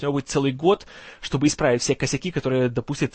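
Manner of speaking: ordinary talk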